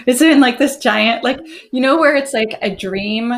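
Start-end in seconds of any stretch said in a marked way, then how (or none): none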